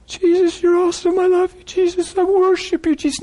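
very high, one-note stream of praise; rapturous praise. Retold or imitated. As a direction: imitate a new convert pouring out praise high. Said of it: "praise filled her lips".

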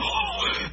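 sharp unvoiced mock gasp; mom's gasp. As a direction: gasp like a shocked mother. Imitates how moms react.

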